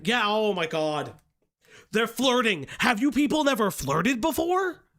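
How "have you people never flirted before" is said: ordinary delivery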